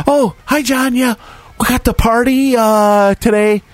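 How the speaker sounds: high-pitched imitation of Nikki, breezy and clueless; high mock woman. Storyteller mimics the party host arriving early, high and breezy. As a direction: squeak it out like a ditzy party host.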